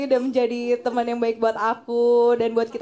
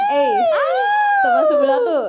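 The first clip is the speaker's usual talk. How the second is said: mock sweet greeting; 6 dB louder than usual. Valentine greeting to friend beside.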